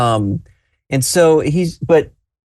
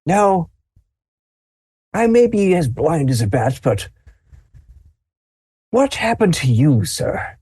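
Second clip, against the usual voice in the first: put-on higher, puzzled old man; old grandfather; 5 semitones higher than usual. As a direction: peer and ask like a half-blind grandpa. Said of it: "he just goes".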